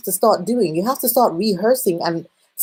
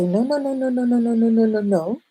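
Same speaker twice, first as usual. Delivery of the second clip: repeated no; 3 semitones higher than usual. Shown as a demonstration. Demonstrates saying no over and over.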